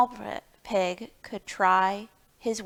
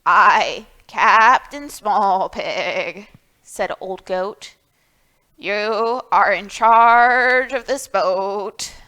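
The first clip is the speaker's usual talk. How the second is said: put-on solemn old goat; 7 dB louder, slower than usual. Old Goat naming captain.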